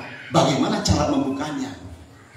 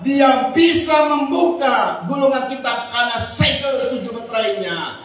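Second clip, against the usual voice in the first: much higher and louder, excited proclamation; fervent preaching; 10 semitones higher, 4 dB louder than usual. Real, not acted. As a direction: preach it with joyful fire. (khotbah berapi-api dengan gembira)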